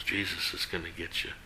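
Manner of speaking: very soft, low parrot voice; hushed, ominous joke warning. Acted. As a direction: say it almost under your breath.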